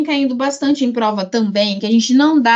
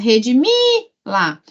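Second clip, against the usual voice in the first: rediMIII, held, then a separate la; detaching the pronoun; slower than usual. Shown as a demonstration. Teacher telling students to forget the pronoun, splitting the word. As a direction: stretch redimila on MI and drop la off the end. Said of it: "como se você esquecesse que isso existe e pensa".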